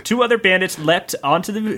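ordinary delivery

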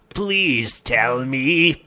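slow drawn-out plea; begging. Acted. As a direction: beg slowly, stretching every word. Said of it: "begged Stinkline".

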